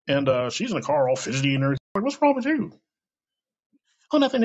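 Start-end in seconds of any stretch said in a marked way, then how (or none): 2.0-2.7 much higher, puzzled question to a fidgety friend. Retold or imitated. Asking fidgety friend in car. 4.1-4.4 higher and louder, a nervous brush-off; nervous denial. Retold or imitated. Nervous friend denies.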